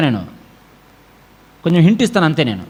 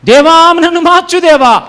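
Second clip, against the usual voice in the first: mock prayer; 8 semitones higher, 6 dB louder than usual. Mocking a believer's shallow prayer.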